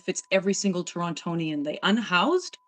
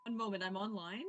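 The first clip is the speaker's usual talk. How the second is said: faint, distant mic audio; quiet, far-off call audio; barely audible; 15 dB quieter than usual. Real, not acted. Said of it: "I can barely hear you".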